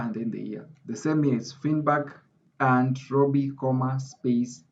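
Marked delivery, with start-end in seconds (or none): 1.5-4.6 comma, space said very slowly and spaced; slow emphasis. Shown as a demonstration. Lecturer stressing comma, space as she types, very slowly. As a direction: drag out comma, space for the class. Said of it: "to emphasize".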